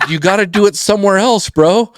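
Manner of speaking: high, pushy telling-off; mock order to leave. Banker ordering the bum to move along, high and firm. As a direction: shoo him away firmly.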